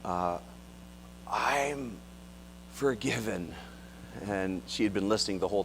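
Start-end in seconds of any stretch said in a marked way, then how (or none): 0.0-3.5 grunted. Retold or imitated. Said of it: "Kathy kind of grunts out".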